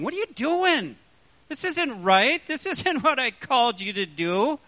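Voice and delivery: "doing" stressed high and firm. Shown as a demonstration. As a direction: lift and punch "doing". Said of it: "put the emphasis on doing".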